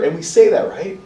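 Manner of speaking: normal talking voice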